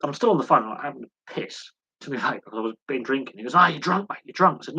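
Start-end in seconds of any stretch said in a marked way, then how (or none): 3.5-4.6 mock accusing friend. Friend accusing him of being drunk.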